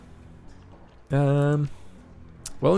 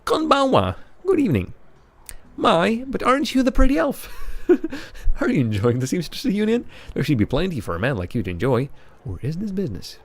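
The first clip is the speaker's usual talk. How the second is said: higher, teasing madam's voice; madam greeting a visitor in a higher voice; club owner's voice; 6 semitones higher than usual. Acted. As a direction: purr at the pretty elf with playful charm.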